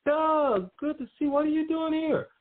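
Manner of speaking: very high, altered imitation of an excited boss; excited greeting. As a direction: play the boss thrilled to see him. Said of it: "He was like".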